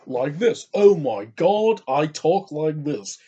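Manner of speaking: slow, loud, swooping pseudo-Oxford British voice; Tony Randall. Acted. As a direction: draw it out in a loud mock-British brain gremlin voice. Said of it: "has a voice of Tony Randall".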